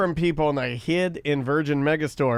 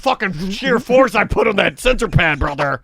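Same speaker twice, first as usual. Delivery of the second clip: louder, higher pro wrestler bellow; Hulk Hogan; 3 semitones higher, 3 dB louder than usual. Retold or imitated. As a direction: boast like a wrestling promo. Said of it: "talking like Hogan".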